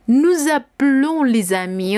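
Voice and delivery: "nous appelons" slowly, single L, nasal ending; slow, stressed "nous appelons les amis"; careful demo. Shown as a demonstration. Teacher modelling the nous form for students, slow and stressed. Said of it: "Observemos qué pasa en nous".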